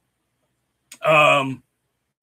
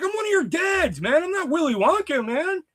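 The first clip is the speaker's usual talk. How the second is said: mock protest; 13 semitones higher than usual. High mock protest to daughter.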